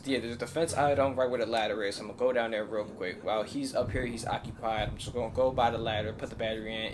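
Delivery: ordinary delivery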